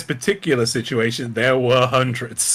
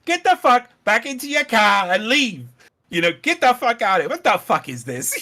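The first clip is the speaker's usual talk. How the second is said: higher, fast mock yelling; imitating yelling woman; 7 semitones higher than usual. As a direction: bark her orders at the cops. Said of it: "she's just yelling".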